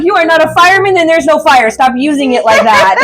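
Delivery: high, exasperated telling-off; amused owner laying down the law; mock scolding. Owner scolding cat sliding down door frames, mock exasperated.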